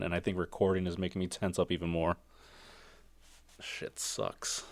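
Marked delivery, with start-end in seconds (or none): 3.6-4.6 under the breath, whispered curse; a whispered aside; muttered whisper. Real, not acted. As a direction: grumble it almost silently to yourself. Aside about painful back knot.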